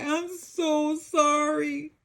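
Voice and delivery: slow, high, fighting back tears; a hint of a sob, slow and high; trying not to cry. A narrator's reading. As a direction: hold the tears in as you apologize. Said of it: "trying not to cry".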